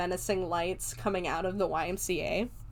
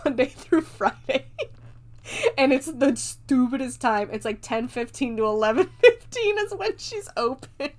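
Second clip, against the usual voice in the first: cracking up while talking; amused, high laughing voice; real laughter; 4 semitones higher, 4 dB louder than usual. Real, not acted. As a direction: push the words out through giggles. Cracking up at absurd opening hours.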